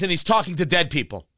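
normal talking voice

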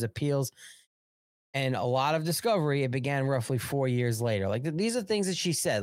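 normal talking voice

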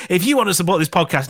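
quick, brighter retake of the plea. Shown as a demonstration. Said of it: "It was a ridiculous thing to say".

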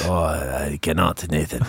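put-on low, slow Russian accent. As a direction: refuse in a deep, dragging Russian voice. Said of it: "Can you do a Russian accent?".

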